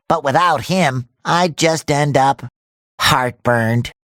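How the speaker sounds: low, slowing, downcast delivery; glum. Acted. Hero without his friend Patrick, glum and sinking low. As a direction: let it sink low and slow, missing your friend.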